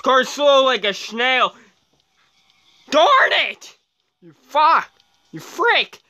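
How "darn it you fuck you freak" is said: high, loud cursing; sudden exasperated yelps; frustrated outburst. Real, not acted. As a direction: curse at a rival mid-race. Cursing during the race.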